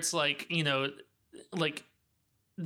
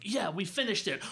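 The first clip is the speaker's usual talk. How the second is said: mock shock; 7 semitones higher than usual. Movie heroes discovering a bigger monster, hammed-up alarm. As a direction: gasp it out as if spotting a bigger monster. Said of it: "where it's like".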